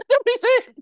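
laughing, very high and loud; laughing hard. Real, not acted. Laughing at the movie line, squealing disbelief. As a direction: crack up while asking.

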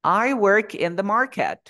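americano lento y marcado, más fuerte, con R; pronúncialo en americano, despacio y proyectado; acento americano. Shown as a demonstration. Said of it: "voy a pronunciarlo en americano". (inglés americano articulado para que lo reconozcan)

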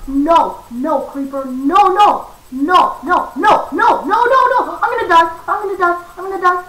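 loud, high frantic screaming; sustained real panic, building to screams; panicked shouting. Real, not acted. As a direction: yell in terror as death nears. Creeper about to explode.

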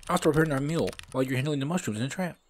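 high, quick sidekick voice; high-pitched, hurried game character voice; Bob. Acted. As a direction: pitch it up and rattle off the plan.

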